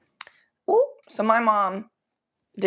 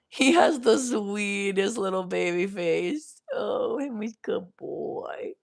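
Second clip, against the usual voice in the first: doting pet talk; slower than usual. Real, not acted. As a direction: gush slowly over your adored pet. Gushing over pet.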